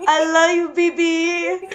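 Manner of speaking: put-on high giggly "I love you, baby"; laughing. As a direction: laugh through it. Laughing imitation.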